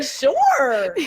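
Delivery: high, bright little "Sure."; perky quote. Retold or imitated. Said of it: "And she was like".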